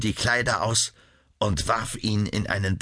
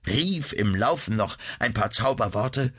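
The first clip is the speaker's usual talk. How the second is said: usual speaking voice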